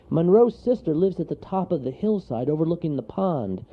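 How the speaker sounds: little creature calling up to a bear; mild shout. A narrator's reading. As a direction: call out directions. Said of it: "shouted Wharton".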